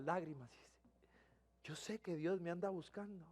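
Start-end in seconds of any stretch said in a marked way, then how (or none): 1.6-3.2 tearful confession. Retold or imitated. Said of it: "Y empieza a llorar, pero de una manera dramática".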